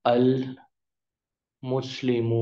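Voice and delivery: المسلم drawn out very slowly; slow teaching pronunciation; slow model word. Shown as a demonstration. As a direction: stretch المسلم. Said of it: "مثال کے طور پر کہتے ہیں".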